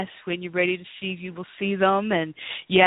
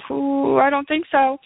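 higher, disbelieving brush-off; incredulous refusal; 8 semitones higher, 4 dB louder than usual. Retold or imitated. She balks at the voice's order, high and incredulous. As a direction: pitch it up in mock disbelief. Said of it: "And I'm like".

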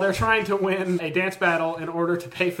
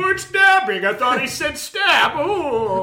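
put-on cranky old man; 5 semitones higher, 4 dB louder, much slower than usual. Old man mishears dab as stab.

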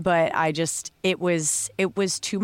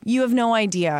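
exasperated retort; 5 semitones higher than usual. Retold or imitated. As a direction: snap it back, fed up.

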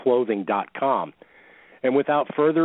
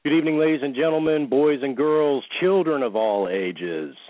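grand ringmaster welcome; 5 semitones higher than usual. Acted. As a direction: open the show like a circus ringmaster. Ringmaster show opening.

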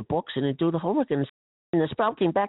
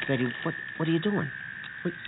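normal talking voice

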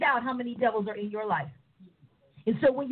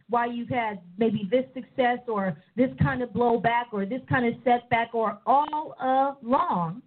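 normal talking voice

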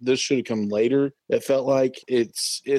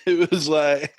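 usual speaking voice

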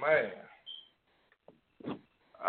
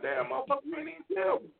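mock indignant surprise; 10 semitones higher, faster than usual. Acting his reply to Kim's boast.

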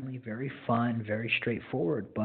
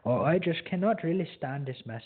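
a light, imitated Mennonite accent, pitched higher; 6 semitones higher than usual. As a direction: mimic the cleaning lady with a mild Mennonite accent. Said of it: "I make a really bad, um, Mennonite accent".

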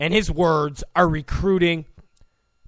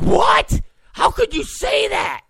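mock outraged shriek; 13 semitones higher than usual. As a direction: shriek it like a scandalized leftist. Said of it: "The left's like".

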